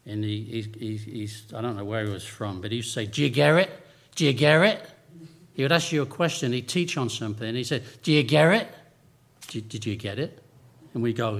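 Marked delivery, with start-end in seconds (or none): 3.1-4.9 mock English schoolteacher accent, louder; imitating an English maths teacher's accent. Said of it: "that's a good traditional English accent for you". 8.0-10.4 mock English schoolteacher asking, loud then dropping; imitating the maths teacher's English accent. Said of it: "that's a good traditional English accent for you".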